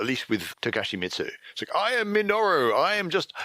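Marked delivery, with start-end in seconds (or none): 1.8-3.3 high, loud mock-heroic claim of a false name; bluffing in disguise. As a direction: announce yourself high and loud, fooling nobody. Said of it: "as part of their cunning disguise".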